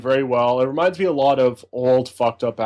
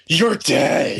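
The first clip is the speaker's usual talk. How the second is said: punchy, higher-pitched mock exclamation; exclaimed title; 4 semitones higher than usual. Shown as a demonstration. Co-host shows how to say the album title, exclaimed. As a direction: shout out the album title with relish. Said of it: "We gotta say it like".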